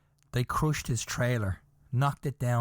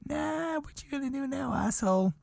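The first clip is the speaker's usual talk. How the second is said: mocking taunt; 12 semitones higher than usual. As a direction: rub it in, teasing. Builders taunting the general.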